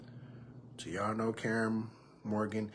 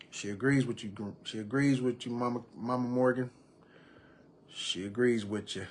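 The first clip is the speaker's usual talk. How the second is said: ordinary delivery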